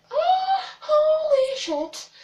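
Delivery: high, loud, slow, stretched cry of shock; retold shock. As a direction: relive being yanked back. Retelling the moment of being grabbed.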